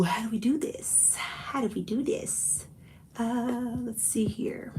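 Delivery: soft, breathy whisper to herself; whispered thinking aloud. Real, not acted. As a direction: mull it over under your breath. Thinking aloud about giveaway.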